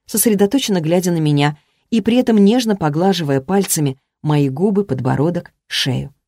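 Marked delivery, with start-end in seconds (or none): none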